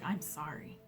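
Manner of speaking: soft, hushed "I'm sorry"; quiet apology. Retold or imitated. Departed loved one apologizing to the grieving person, hushed. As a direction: let the apology fall away, barely there. Reader voicing the spirit's apology.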